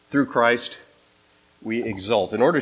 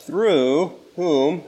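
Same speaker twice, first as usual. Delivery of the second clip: "through" raised and punched before "whom"; emphasis on through; 5 semitones higher, 4 dB louder than usual. Shown as a demonstration. Preacher flagging a repeated word for listeners, "through" heavily stressed. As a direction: make "through" stand out from the verse. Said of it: "to emphasize a word".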